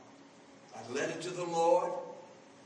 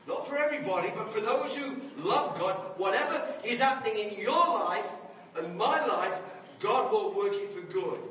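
preaching; 2 semitones higher, 4 dB louder than usual. Acted. Preaching the verse's promise.

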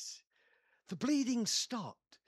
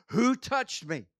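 slow, deliberate, raised question; slow, firm asking; 3 semitones higher, 8 dB louder, slower than usual. Acted. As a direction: ask it slowly and firmly, not yet shouting. First asking, before the shout.

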